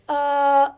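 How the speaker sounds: UH held long and loud; isolated schwa sound. Shown as a demonstration. Teacher picking out the schwa in advisor, held sound. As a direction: stretch out a single UH. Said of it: "I hear a schwa sound".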